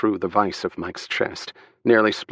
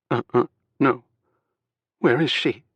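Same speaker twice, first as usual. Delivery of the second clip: fragile pleading; 5 semitones higher than usual. A narrator's reading. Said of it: "Her voice had turned fragile, tiny, pleading".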